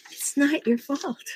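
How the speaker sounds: normal talking voice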